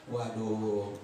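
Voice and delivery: ordinary talk, nothing marked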